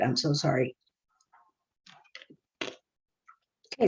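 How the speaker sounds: throat failing, voice dropping away; voice giving out. Real, not acted. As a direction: voice fading to a breathy whisper. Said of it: "my throat and my voice is giving out".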